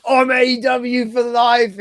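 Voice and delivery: high, slow, hammed-up heel declaration; mock wrestler promo. Fan mocking Gabe Kidd's turn, hammed-up promo voice. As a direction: ham up a wrestler's loyalty boast. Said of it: "And he's like".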